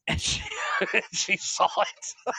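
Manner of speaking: squeezing words out through laughter; slow, halting, overcome with real laughter; breaking up. Real, not acted. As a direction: laugh your way through the punchline.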